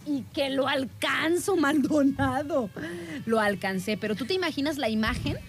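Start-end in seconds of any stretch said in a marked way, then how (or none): none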